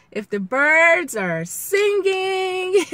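high, loud, drawn-out and elated; playful singsong. Real, not acted. Vlogger cheering on viewers, playful singsong. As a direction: stretch it out high and giddy. Playful picture of good times.

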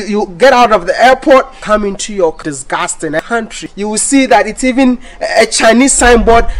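ordinary delivery

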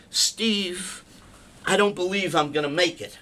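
ordinary delivery

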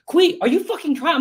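high, mock angry father; hammed-up frustrated dad; dad scolding his son, high-pitched mock anger; exasperated dad. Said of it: "they would come home and like".

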